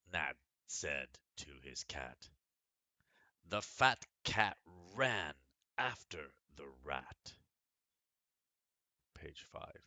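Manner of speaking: lively storybook reading; story reading. Acted. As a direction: read it like a picture book to children. Reads a picture book aloud.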